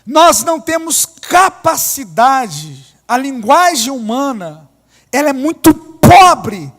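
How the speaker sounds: loud, slow, emphatic sermon; fervent preaching. Acted. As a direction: preach it hard, hammering each phrase. Preaching at full force.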